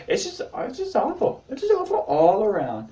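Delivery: high squealed lament; mock despair. Summing up his awful situation, high mock despair. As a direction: start squealing high, then slide down.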